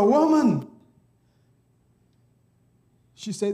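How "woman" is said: angels calling. Acted. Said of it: "And then they ask her".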